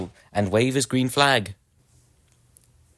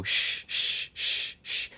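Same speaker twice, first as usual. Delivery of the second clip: steam puffing sounds; much slower than usual. Acted. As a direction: puff like a steam engine pulling away. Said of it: "Edward puffed away".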